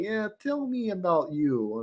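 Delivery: put-on high, soft, slow Russian accent. Imitating the Putin lookalike to his co-host, Russian accent. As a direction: put on an old Russian man's accent, drawn out. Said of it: "in this Russian accent".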